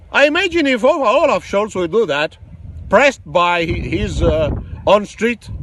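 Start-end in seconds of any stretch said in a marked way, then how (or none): none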